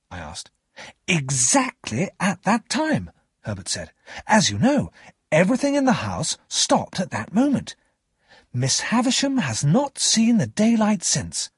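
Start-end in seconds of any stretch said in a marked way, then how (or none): 1.1-3.1 higher, slow and emphatic confirmation; lightly acted, higher character voice; Herbert. 4.3-11.5 higher-pitched, emphatic retelling; lightly acted, lilting character voice; lightly voiced young storyteller.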